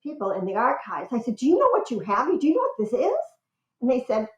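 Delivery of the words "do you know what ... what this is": high-pitched, thrilled retold questions, climbing higher; excited. Researcher showing the picture to archives staff, excited. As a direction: ask as if they're sitting on a treasure. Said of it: "I was so excited".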